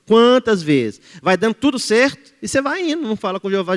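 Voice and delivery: emphatic rhetorical cry. Real, not acted.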